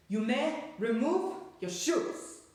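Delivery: slow, high, loud harsh witch command; witch shouting. A narrator's reading. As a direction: rasp out the order to the whole room.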